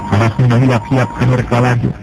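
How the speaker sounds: ordinary delivery, nothing marked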